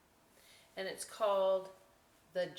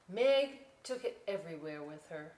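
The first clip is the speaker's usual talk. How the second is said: usual speaking voice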